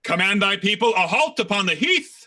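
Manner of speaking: ringing actor's proclamation; stage declamation. Acted. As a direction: deliver it as Shakespeare to the back row. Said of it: "and I say".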